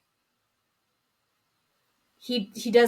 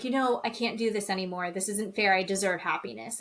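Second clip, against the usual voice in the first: ordinary talk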